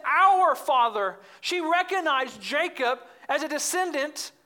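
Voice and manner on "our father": Our father said slowly and much louder, Our hit hard; stress on Our father. Shown as a demonstration. Preacher pointing out the woman's claim, slow emphatic. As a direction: drag out Our father, weight on Our. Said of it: "Notice she says".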